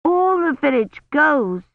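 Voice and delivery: put-on high, slow, exasperated child; impatient. A narrator's reading. Little girl answering who goes along, impatient. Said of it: "she said impatiently".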